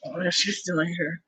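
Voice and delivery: quiet, put-on voice of a friend; lightly voiced friend, hushed; Kim voice. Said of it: "let me do a Kim".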